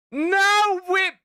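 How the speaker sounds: high loud outraged yell; high shriek of disbelief; shocked shout. Real, not acted. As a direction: blurt it out in horror. Shocked outburst.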